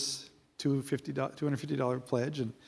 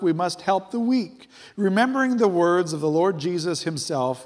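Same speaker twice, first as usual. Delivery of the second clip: higher, punchy preacher's delivery; emphatic preaching; 5 semitones higher, 4 dB louder than usual. Real, not acted. Preacher telling the church why God prospers them, emphatic preaching. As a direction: preach it with rising force and hard stresses. Preacher drives home his point.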